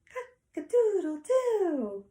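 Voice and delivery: high, loud, drawn-out crow; rooster crow. Acted. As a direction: crow slowly like a rooster, falling at the end. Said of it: "A rooster says".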